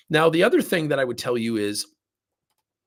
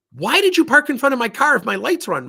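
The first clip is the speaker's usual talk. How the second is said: loud, much higher confrontation; confronting; 14 semitones higher, 8 dB louder than usual. Retold or imitated. Confronting the driver in the parking lot, angry and loud. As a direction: challenge him hard. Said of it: "I went up and confronted him".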